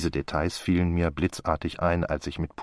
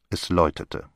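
usual speaking voice

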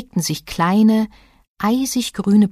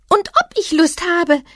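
much higher, slow delighted little creature; eager call; 12 semitones higher than usual. A narrator's reading. Little animal gladly accepting to come along, high and eager. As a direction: jump at the invitation. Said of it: "rief das kleine Tier".

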